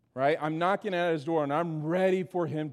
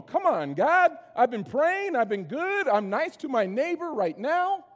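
higher, pleading whine, as if owed; whiny complaint to God; 7 semitones higher than usual. Pretend. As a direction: complain to God that you have earned it. Believer complaining to God in prayer.

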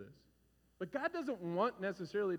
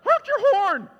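high loud frantic yelling; mother shouting from the passenger seat; panicked shout; 22 dB louder, much slower than usual. Retold or imitated. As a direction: scream at the driver to honk. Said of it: "Michelle ... going".

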